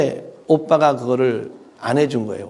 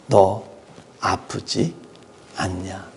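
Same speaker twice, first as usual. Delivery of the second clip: mother's concern; 5 semitones lower, 3 dB quieter, much slower than usual. Retold or imitated. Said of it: "감싸줘요".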